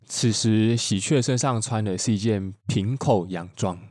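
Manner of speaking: normal talking voice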